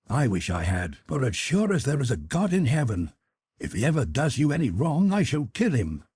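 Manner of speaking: lightly voiced lad, vowing menace; a touch of a jealous brother's threat; Jim, a fierce vow. Brother vowing revenge on his sister's lover, lightly menacing.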